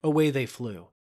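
plain reading voice

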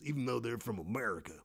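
ordinary talk, nothing marked

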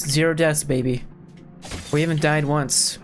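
normal talking voice

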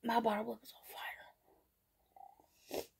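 whispered, breath only; pained whisper. Real, not acted. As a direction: whisper it through the burn. Lip burning from spicy food.

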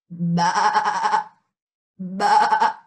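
low, wavering bleat; imitated sheep baa with a rapid wobble; sheep imitation. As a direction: bleat like a sheep in two bursts. Imitates sheep for guessing game.

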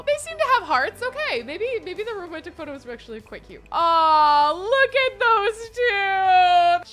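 high squealing coo over something cute; high-pitched aww. Real, not acted. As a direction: gush like it's adorable. Cooing over cute couple photo.

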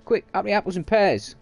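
jokey East End Cockney rhyming slang. Pretend. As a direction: bark it like a cheeky Cockney.

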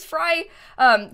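ordinary talk, nothing marked

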